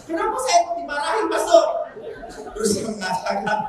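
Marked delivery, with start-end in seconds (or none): 0.1-1.6 nada protes anak yang lebih tinggi; anak bertanya heran. Retold or imitated. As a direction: tiru anak yang mengeluh kepada pastor. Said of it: "Lalu ditanya". (bertanya heran dengan nada tinggi)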